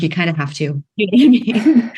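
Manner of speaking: amused disbelief; laughing. Real, not acted. Founder retelling her reply about mentioning boobs, laughing.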